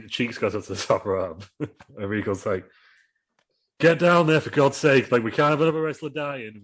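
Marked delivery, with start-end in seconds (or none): 3.8-6.6 higher and louder, fed up; exasperated yell. Acted. Regal ordering Cheeks off the top rope, exasperated loud yell. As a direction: shout him down off the rope, exasperated. Regal yells at stuck wrestler.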